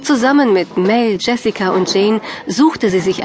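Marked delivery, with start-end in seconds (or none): none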